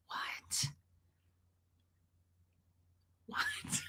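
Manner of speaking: imitated whisper of shock; stunned whisper. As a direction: whisper it, as if you can't believe it. Retells son's stunned reaction to death.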